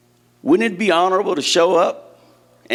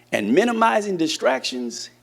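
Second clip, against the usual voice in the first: normal talking voice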